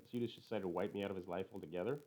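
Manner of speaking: usual speaking voice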